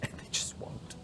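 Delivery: whispered under the breath. Real, not acted. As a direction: hiss it out in exasperation. Frustrated aside under breath.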